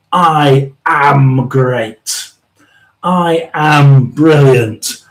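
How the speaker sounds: each word said very slowly and deliberately; very slow self-affirmation. Retold or imitated. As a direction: say it like a mirror mantra, slowly. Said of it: "Albert's just said".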